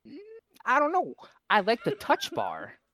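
put-on high, loud fussy old lady voice. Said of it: "And she went".

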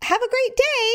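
high, airy brush-off goodbye; breezy nurse. Retold or imitated. Mammogram tech breezily sending the patient off, high and light. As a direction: chirp it like a nurse dismissing her patient. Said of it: "she said".

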